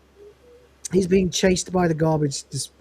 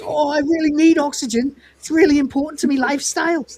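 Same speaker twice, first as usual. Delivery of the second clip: mock pleading; 7 semitones higher, 3 dB louder than usual. Acting out a plea for oxygen.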